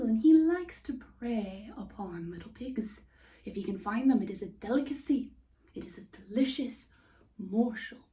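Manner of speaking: ordinary delivery, nothing marked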